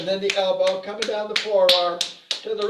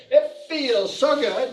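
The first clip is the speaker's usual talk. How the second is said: higher, slow, emphatic; affirmation called out; 7 semitones higher, slower than usual. Shown as a demonstration. Teacher leads the group affirmation, slow emphatic call. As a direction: call it out for the class.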